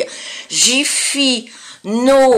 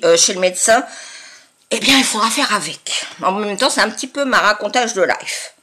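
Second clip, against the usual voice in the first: ordinary talk, nothing marked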